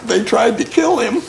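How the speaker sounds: high, loud, cracking up; fighting through the laughter; real laughter during the war story; laughing while telling. Real, not acted. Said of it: "he's laughing so goddamn much".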